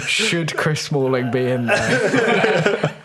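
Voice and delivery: slow, laughing disbelief. Retold or imitated. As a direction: giggle through the question. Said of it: "I'm like".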